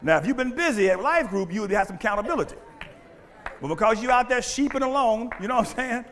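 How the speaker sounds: loud, driving preacher's cry; full-force preaching. Acted. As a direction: preach it hard to the congregation.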